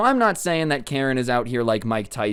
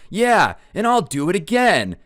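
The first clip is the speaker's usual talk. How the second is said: defiant Karen; 8 semitones higher, 4 dB louder than usual. Retold or imitated. Karen defiant after punching.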